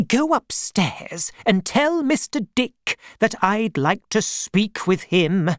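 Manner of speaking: put-on high, brisk old aunt voice; aunt sending Janet upstairs; aunt giving orders. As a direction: command the servant.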